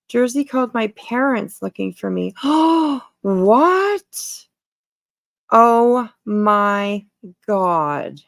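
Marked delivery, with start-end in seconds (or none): 2.4-3.0 loud, high startled gasp; sharp gasp. Real, not acted. Streamer hears shocking news about Emmy, gasping. 3.2-8.2 shocked what. Real, not acted. Streamer hears Emmy's parents were called, stunned.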